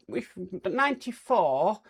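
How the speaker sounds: normal talking voice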